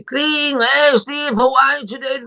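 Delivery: overly upbeat morning caller; excited greeting. Retold or imitated. Friend's cheery call retold.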